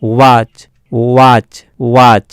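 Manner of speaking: "ওয়াচ" repeated, each opened with a rounded w-glide; w as o plus bo. Shown as a demonstration. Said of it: "বয়ের উচ্চারণ আসছে".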